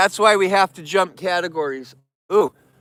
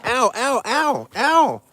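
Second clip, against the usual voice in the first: higher, pained ow cries; real pain yelps; 6 semitones higher, slower than usual. Real, not acted. Bitten by a bear cub, higher pained yelps. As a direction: yelp as the bite lands.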